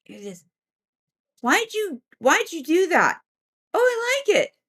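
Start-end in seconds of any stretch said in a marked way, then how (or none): 1.4-3.2 high-pitched complaining voice; scolding spirit. Retold or imitated. One unseen being questions another, high mock complaint. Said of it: "I hear them talking telepathically". 3.7-4.6 very high little voice; gleeful troublemaker. Retold or imitated. Unseen prankster answers its companion, high playful voice. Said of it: "I hear them talking telepathically".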